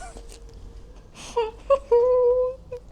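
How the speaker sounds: high-pitched, squealing laugh; helpless cringing giggle. Real, not acted. Giggles over a cringey line.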